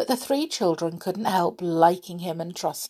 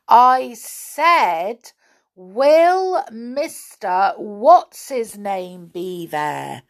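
slow, emphatic raised voice; loud repetition; 4 semitones higher, 6 dB louder, much slower than usual. A narrator's reading. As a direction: repeat it loudly for deaf ears, word by word. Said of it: "said Joe loudly".

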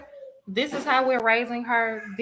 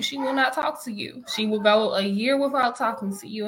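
normal talking voice

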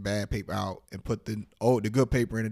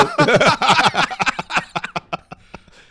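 long loud high laughter; 12 semitones higher, 11 dB louder than usual. Real, not acted. Cracking up at the coworker's refusal, loud laugh. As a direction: howl with laughter at your own joke.

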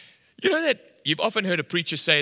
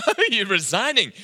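high, laughing echo question; amused disbelief; 7 semitones higher, 5 dB louder than usual. Retold or imitated. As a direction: laugh while repeating it back. Amused echo of waiter's news.

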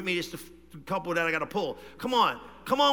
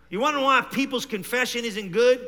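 normal talking voice

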